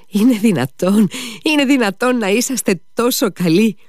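deeply moved shout. A narrator's reading. Mitya cries out to the lady, overwhelmed with emotion. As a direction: cry out in disbelieving joy. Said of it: "φώναζε πολύ συγκινημένος".

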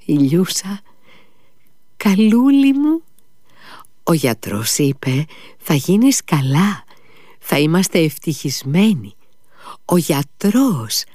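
a father trying to comfort through tears; captain reassuring his dying son through tears; weeping father. Acted. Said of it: "άρχισε να λέει ο λοχαγός".

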